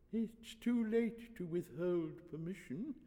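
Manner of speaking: put-on old high priest. Old priest answering the father, frail voice. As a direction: dodder indulgently.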